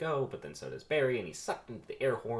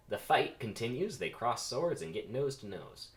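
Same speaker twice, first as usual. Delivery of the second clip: usual speaking voice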